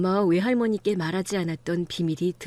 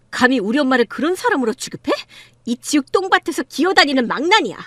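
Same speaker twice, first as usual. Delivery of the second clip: loud, raised-pitch tirade; furious outburst; 3 semitones higher, 5 dB louder than usual. Acted. Daughter defending her mother from her father, furious outburst. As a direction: hurl the insult with rising fury.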